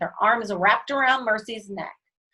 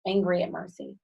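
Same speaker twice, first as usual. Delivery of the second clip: ordinary delivery, nothing marked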